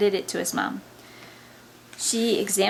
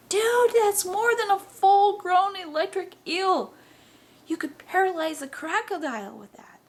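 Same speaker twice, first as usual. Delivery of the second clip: amazed excitement; 10 semitones higher, 4 dB louder than usual. Acted. Friend amazed at eight hundred sixty-four volts, excited. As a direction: gush in high-pitched disbelief.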